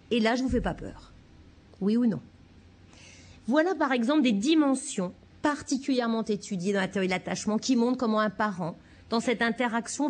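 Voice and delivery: normal talking voice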